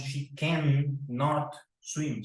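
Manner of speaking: slow, deliberate model; careful slow model; slow careful correct form. Shown as a demonstration. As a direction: spell out the right negative slowly.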